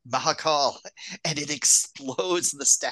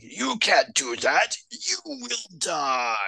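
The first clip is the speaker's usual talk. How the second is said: villain warning; 6 semitones higher, slower than usual. Retold or imitated. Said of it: "And Baba's like".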